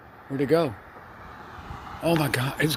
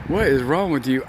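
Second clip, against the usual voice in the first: usual speaking voice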